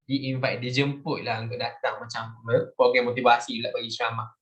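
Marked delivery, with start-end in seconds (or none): none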